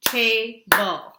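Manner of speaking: loud syllable-clapping demo; clapped syllables. Shown as a demonstration. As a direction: clap out each syllable of "Table". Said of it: "Can you clap it?".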